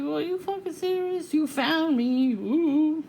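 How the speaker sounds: high, slow mocking sing-song; mock the stalkers with fake awe. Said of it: "I'm making fun of them".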